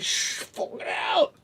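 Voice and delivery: high, slow whispered shush; imitated hush. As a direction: hiss at him to be quiet. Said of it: "And I'm like".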